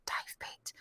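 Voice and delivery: whispered key phrase, under the breath; whispering to oneself. Retold or imitated. Actor slipping into an accent at an audition, whispering. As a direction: whisper it privately to yourself. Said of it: "keep whispering to myself under my breath".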